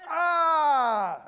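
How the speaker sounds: loud, high delighted ah. Retold or imitated. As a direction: cry out in relief at finally finding him. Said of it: "she looked very pleased to see me".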